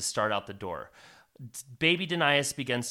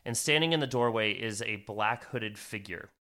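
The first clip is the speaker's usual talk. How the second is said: ordinary delivery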